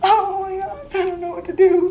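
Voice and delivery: high, whimpering helpless wail; mock panic. Retelling being stuck with roommate's mother, whimpering panic. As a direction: play up the helplessness, pitch way up.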